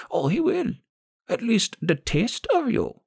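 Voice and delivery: put-on relishing old crone, high and gravelly; gravelly old woman. A narrator's reading. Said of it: "The old woman's gravelly voice".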